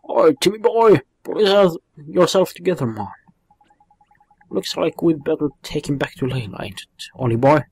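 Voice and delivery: loud, cheeky sidekick telling the cat off; scolding sidekick. Acted. Sidekick rousing the lazy cat, loud and cheeky. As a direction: rouse the cat, bossy and cheeky.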